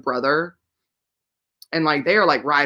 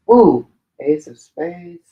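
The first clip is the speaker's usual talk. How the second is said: excited; 10 dB louder, slower than usual. Real, not acted. Spots a book title.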